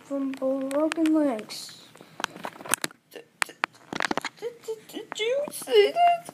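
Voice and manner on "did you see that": high, slow crying words. Acted. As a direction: sob the question out slowly. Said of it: "The Goomba's crying".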